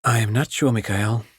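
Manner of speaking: usual speaking voice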